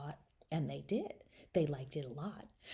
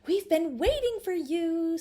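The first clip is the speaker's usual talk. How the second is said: higher, louder sweet doll mother; doll mommy; 11 semitones higher, 6 dB louder than usual. Acted. Doll mommy welcoming Charlotta, high and sweet. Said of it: "said the doll mommy".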